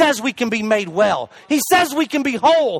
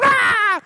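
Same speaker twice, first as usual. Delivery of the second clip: high re-enacted scream; long, high "ah" holler; panicked scream; 12 semitones higher than usual. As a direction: imitate yourself screaming in fright. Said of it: "when I was going".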